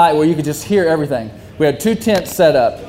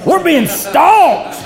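much higher, very slow preaching wail; Pentecostal preacher; 11 semitones higher, much slower than usual. Retold or imitated. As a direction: stretch it out like a revival sermon. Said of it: "like a Pentecostal preacher".

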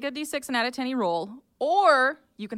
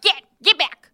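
high, very loud shouted command; full shout; 10 semitones higher, 12 dB louder than usual. Acted. Player fends off the centipede, full shout. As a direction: shout it sharply to drive a beast off. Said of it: "So you shout".

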